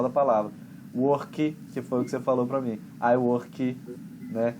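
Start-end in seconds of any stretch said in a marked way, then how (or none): 0.9-1.6 "Work" as "wor-kee", Brazilian-style added final vowel; Brazilian accent. Retold or imitated. Teacher imitating a student's mistake, Brazilian accent. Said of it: "pronúncia portuguesada, brasileirada, de forçar o final". 3.0-3.8 "I work" as "I wor-kee", Brazilian ending; Brazilian accent. Retold or imitated. Teacher echoing what the student said, Brazilian accent.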